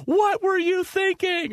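high mock scream of a shocked mother; inner scream. Wife acts out her inner scream at the kids' news. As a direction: shriek the question the way she feels it inside. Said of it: "inside she's screaming".

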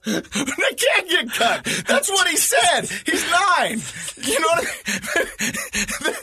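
words squeezed out between laughs; laughing. Real, not acted. Dad retelling son's joke to cohost, laughing helplessly.

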